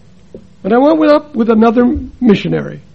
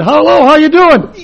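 louder, higher hearty hello; too loud greeting; 6 semitones higher, 7 dB louder than usual. Retold or imitated. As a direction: boom the greeting like a street preacher. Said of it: "I said".

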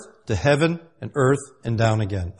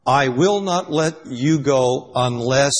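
slow, deliberate words, held open on UNLESS; emphatic build-up; much slower than usual. Shown as a demonstration. Jacob to the one he wrestled, emphatic slow lead-in. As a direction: measure out each word up to UNLESS, leaving it hanging.

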